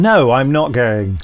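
ordinary delivery

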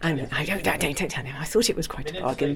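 low, hushed confiding voice; softly letting slip a secret; confidential aside. Real, not acted. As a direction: keep it between the two of you. Don't tell him, confiding.

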